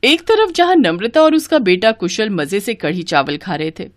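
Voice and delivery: usual speaking voice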